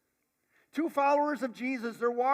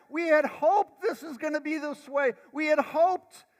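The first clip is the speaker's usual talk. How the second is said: louder, higher "We had hoped" with the "had" stressed; impassioned lament; 7 semitones higher, 9 dB louder than usual. Retold or imitated. Disciples' dashed hope, preached hard.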